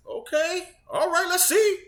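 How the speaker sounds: high, drawn-out singsong doubt; skeptical okay. Retold or imitated. Gamer recalling his first reaction to the trailer, high-pitched skepticism. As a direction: voice climbing high on a wary "let's see".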